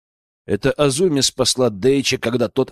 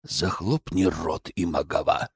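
lower, softer, slow menace; 7 semitones lower, 7 dB quieter than usual. Acted. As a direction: growl it down low. Yoichi silences Akira.

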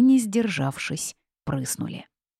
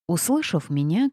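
usual speaking voice

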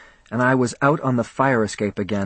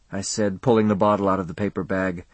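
ordinary delivery

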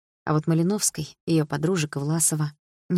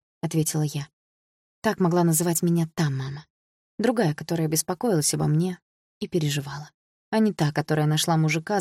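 ordinary delivery

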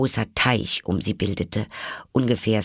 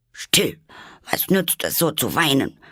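high, stern self-scolding; cross with herself; 7 semitones higher than usual. A narrator's reading. Alice scolding herself for crying, stern and high. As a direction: scold yourself sharply to stop crying. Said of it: "sagte Alice ganz böse zu sich selbst".

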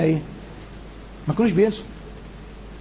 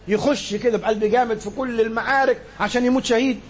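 ordinary talk

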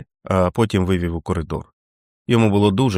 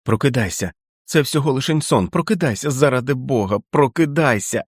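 urgent; 5 semitones higher than usual. A narrator's reading. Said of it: "трясти Річарда, повторюючи".

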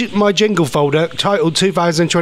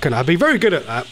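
ordinary talk, nothing marked

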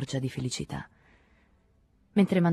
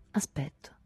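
whisper; 5 dB quieter than usual. A narrator's reading. Tired lover answers curtly.

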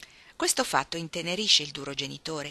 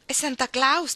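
high and louder, delighted; excited guess; 8 semitones higher, 6 dB louder, slower than usual. Acted. Beth guessing who sent the feast, bright excited call. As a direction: blurt out the happy guess. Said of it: "disse Beth".

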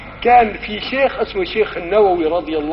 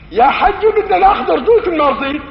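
higher and louder, urgent demand; forceful insistence; 9 semitones higher, 4 dB louder than usual. Acted. Angels insisting to the old man, loud urgent demand. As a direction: push hard, no room to refuse.

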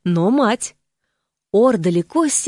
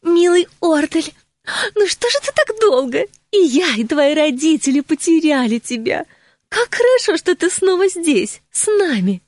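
high sugary fake delight; fake joy; 7 semitones higher than usual. A narrator's reading. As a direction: gush with pretend gladness. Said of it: "изображая радость".

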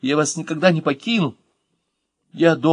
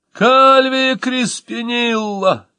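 high, drawn-out wailing call, much higher; wailing cry; 11 semitones higher, 4 dB louder, much slower than usual. A narrator's reading. The moth wailing the nanny's mock name, a high drawn-out cry. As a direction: wail the name out theatrically. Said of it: "завопил мотылёк".

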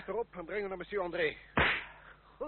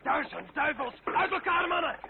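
louder, higher battle cry to scatter the men; shouted orders; 6 semitones higher, 8 dB louder than usual. Acted. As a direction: bellow the command across the field. Officer under fire shouting orders.

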